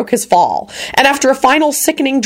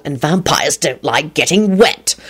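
slow, menacing taunt; growl; 3 semitones lower, slower than usual. A narrator's reading. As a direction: growl it out slowly. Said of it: "Lupin growled".

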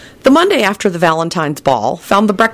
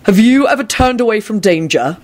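higher, challenging question; impatient; 6 semitones higher than usual. A narrator's reading. Quentin challenging his friend's cowardice talk, impatient. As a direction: press him impatiently. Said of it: "Quentin snapped impatiently".

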